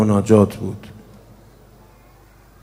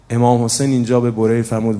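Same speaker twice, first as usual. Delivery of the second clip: ordinary talk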